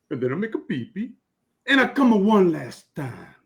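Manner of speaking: put-on broad joke-telling Italian accent, loud; hammed-up Italian immigrant accent. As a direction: tell it like Luigi from the old country. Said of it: "Luigi looks over at Giuseppe and says".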